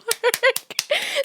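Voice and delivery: sudden high-pitched laugh; high burst of laughter. Real, not acted. Cracking herself up at work.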